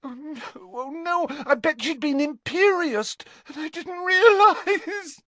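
high tearful account, swelling to a wail; sobbing. A narrator's reading. Leanne confessing about the argument, sobbing. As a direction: tell it in distress, breaking into crying. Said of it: "Leanne shook with renewed sobs".

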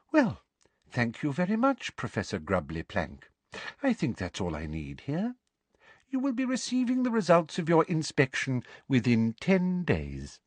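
Umbridge voice. Acted.

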